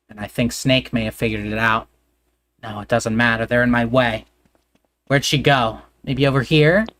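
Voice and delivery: ordinary talk, nothing marked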